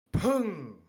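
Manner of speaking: sharp pung sound effect; vocal sound effect. A narrator's reading.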